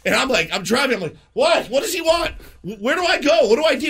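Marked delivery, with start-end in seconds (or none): 1.3-3.9 high-pitched retold panic; panicked. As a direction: act out a flustered driver's frantic questions. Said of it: "So I panic".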